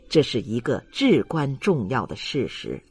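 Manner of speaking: usual speaking voice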